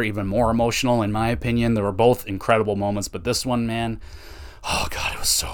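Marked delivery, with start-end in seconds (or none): 4.6-5.5 breathy hush, voice dropping out; awed whisper. Real, not acted. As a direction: whisper it, overcome by the moment. Overwhelmed recalling emotional ceremony.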